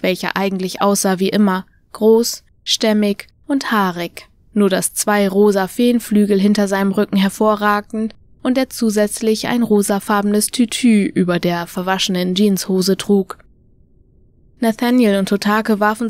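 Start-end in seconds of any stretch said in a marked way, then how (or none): none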